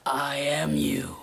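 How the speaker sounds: slow, breathy villain whisper; sinister whisper. Acted. Villain whispers the twist to his victim, slow. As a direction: hiss the reveal slowly.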